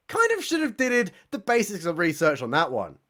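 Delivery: normal talking voice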